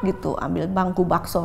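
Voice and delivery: ordinary talk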